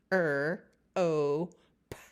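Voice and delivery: rope said as r, o, p, each sound alone. Shown as a demonstration. Teacher showing rope has three sounds. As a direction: sound out r, o, p separately. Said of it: "it only has three sounds".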